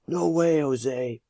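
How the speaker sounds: high mock yell of a grandpa; Papa yelling. Teacher reading to students, playful high grandpa yell. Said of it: "Papa would yell".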